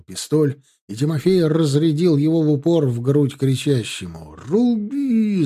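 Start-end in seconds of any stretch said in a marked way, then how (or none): 4.5-5.4 high, drawn-out battle yell; high-pitched charge cry; yelled command. A narrator's reading. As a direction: yell the order to attack. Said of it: "заорал Чеботарёв".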